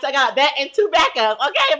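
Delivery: ordinary talk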